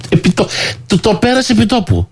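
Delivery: high, indignant retelling; incredulous outburst. Acted. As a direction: exclaim it in amazement. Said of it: "repeated climax".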